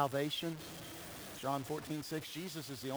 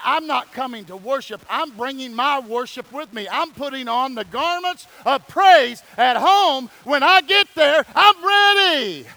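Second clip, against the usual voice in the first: rising, louder and higher to a shout; preaching at full force; 8 semitones higher, 15 dB louder than usual. Acted. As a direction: build the sermon to a shouted peak.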